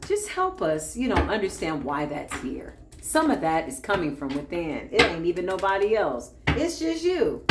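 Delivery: normal talking voice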